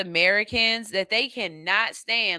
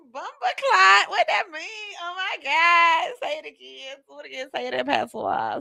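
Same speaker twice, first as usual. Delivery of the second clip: swooning; 8 semitones higher than usual. Acted. Accent lover begging to hear patois, giddy high swoon.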